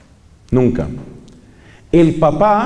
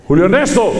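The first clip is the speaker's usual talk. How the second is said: mild mock stern call of the son's full name; lightly voiced strict dad summoning his son; stern father calling; 2 semitones higher, faster than usual. Preacher imitating his father calling him by full name, mock stern.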